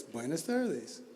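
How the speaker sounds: put-on quiet, slow greeting from the old man; old man's reply. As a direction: answer softly and unhurried, like the old stranger. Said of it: "He goes".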